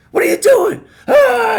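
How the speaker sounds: high, loud furious yell; freaking out. Retold or imitated. Angry manager bursting in on worker's break, yelling. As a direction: blow up at him. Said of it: "he starts freaking out".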